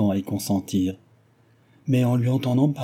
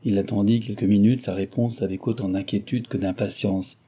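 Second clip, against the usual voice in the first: ordinary delivery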